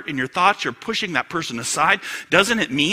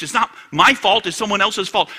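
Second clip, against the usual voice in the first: loud, high mock protest; protesting excuse; 5 semitones higher, 6 dB louder than usual. Excuse-maker dodging blame, loudly protesting. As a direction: act out passing the blame, pitched up.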